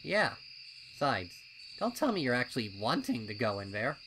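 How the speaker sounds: loud, fast, doubting colt voice; a wary colt talking his friend out of it; skeptical colt. A narrator's reading. Snips questioning Pipsqueak's wish to enter, loud and fast.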